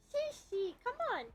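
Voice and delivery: high coaxing call to the cat. Real, not acted. Streamer calling her cat down, high-pitched coaxing.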